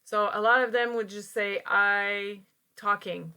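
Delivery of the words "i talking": slow, deliberate wrong example; wrong form, slowed. Shown as a demonstration. Teacher slowly modelling a learner's error. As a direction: drag out the mistake so learners hear it. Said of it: "No, no".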